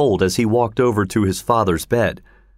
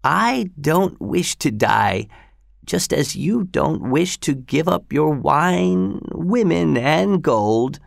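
put-on high, slow, weak old man; 6 semitones higher, slower than usual. A narrator's reading. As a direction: struggle out each word, failing. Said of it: "he continued in a weak voice".

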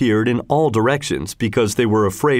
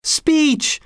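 very high heckler's call; high-pitched demand; crying out. A narrator's reading. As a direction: call from the edge of a crowd. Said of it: "cried someone from the edge of the crowd".

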